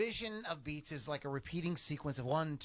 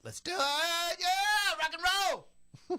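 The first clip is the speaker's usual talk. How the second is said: high, loud rock-star yell; mock rock-and-roll cheer; hammed-up hype; 8 dB louder, slower than usual. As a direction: pump the room up like a stage act. Hyping up before recording.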